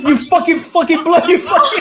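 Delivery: high, loud parody of the clip's swearing, laughing through it; mock Indian accent. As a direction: gleefully imitate the angry Indian guy.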